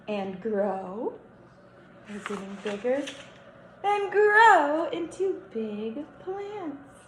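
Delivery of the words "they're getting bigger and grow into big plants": drawn-out storybook read. Acted.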